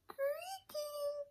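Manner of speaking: high thin creak; creaky door voice. Acted. Sound word in story.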